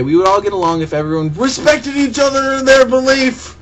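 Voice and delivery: mocking voice. Mocking an opponent's argument.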